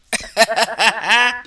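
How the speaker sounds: short real laugh; burst of laughter. Real, not acted. Laughing at the comment.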